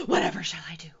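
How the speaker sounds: loud, breathless despair; flustered keeper at a loss; panicked. A narrator's reading. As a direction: cry it out helplessly. Keeper at his wits' end.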